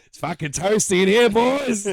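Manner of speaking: loud, high mock call to the room; mock crowd call. Comic calling out to a silent room, loud and high. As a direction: bellow it at a dead audience.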